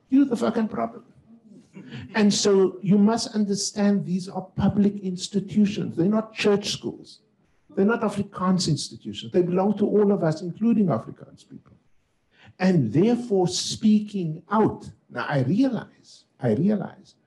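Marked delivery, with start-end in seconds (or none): 0.1-1.0 a sudden high, slightly louder retold outburst; mock-heated telling-off, pitched high; angry rebuke. 2.1-14.9 impassioned speech, high and deliberate; rousing preaching. Acted. Rousing appeal to audience.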